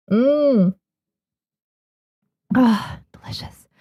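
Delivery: savoring a sip of tea; sipping hot tea. Real, not acted. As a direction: sigh after a hot sip. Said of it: "let me take a sip of this tea".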